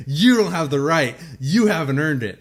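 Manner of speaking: loud, high, hammed-up telling-off; mock indignant rant. Host claims sole right to tease co-host, mock outraged. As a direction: lay down the law in playful outrage.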